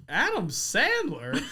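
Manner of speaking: slow, drawn-out mock celebrity name call; a lightly voiced, terrible Sandler; coworker's goofy headset bit; bad Adam Sandler impression. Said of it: "It's a terrible impression".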